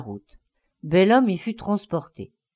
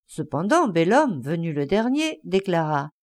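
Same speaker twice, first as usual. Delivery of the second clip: plain reading voice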